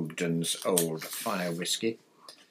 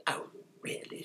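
soft, breath-heavy exasperation; exasperated; 7 dB quieter than usual. A narrator's reading. Father fed up with the article, exasperated. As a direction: let it out under the breath, fed up. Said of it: "said Mister Weasley in exasperation".

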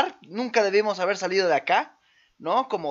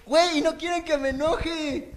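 stifled giggles; 7 semitones higher than usual. Real, not acted. Laughing fit after camera mishap.

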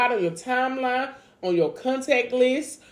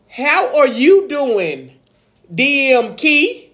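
slow host greeting; 3 semitones higher, 4 dB louder, much slower than usual. Real, not acted. Intro of co-host.

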